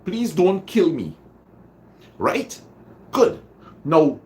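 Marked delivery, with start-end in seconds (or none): 0.0-1.2 high pleading; begging. Shown as a demonstration. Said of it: "You are begging".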